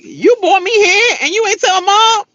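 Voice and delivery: high, loud mock outrage; indignant protest. Voices sister's indignant protest.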